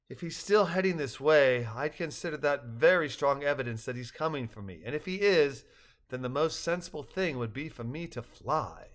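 ordinary delivery

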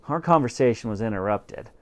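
ordinary delivery

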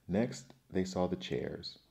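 ordinary delivery, nothing marked

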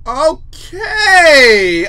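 loud, high, long-drawn "okay"; big taken-aback outburst; scandalized okay. Real, not acted. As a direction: stretch it out in shocked amusement. Shocked by suddenly lewd text.

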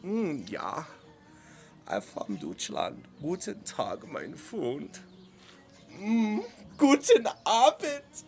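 lilting American caricature of a feminine German accent. Shown as a demonstration. Streamer showing Americans' two German accents, the feminine German one. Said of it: "We have the kind of feminine".

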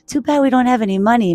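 lightly whiny storybook line; regretful character voice. Acted. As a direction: voice a disappointed little mitten. Mitten frets about money.

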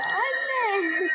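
a high, mock frightened call for mom; replaying his scream. Gamer mimicking his own earlier scream for viewers, lightly scared. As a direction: re-enact the scared yell, half laughing at yourself. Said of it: "Yalnız o bağırışı nasıl ya?".

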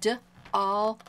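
"D-all" split into a D sound, then "all"; phonics-style "D-all", first sound isolated. Shown as a demonstration. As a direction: sound out "D-all" in separate pieces. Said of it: "see this word?".

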